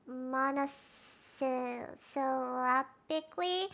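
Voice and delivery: monosyllabically sounded out slowly, piece by piece; slow, halting attempt at monosyllabically; stumbling pronunciation. Real, not acted. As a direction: grope through monosyllabically, tripping mid-word. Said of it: "I can't speak".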